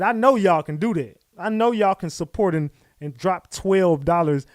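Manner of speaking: hyped fundraising plea to the viewers; loud push. Real, not acted. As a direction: lean on the chat to chip in. Pushing chat to donate.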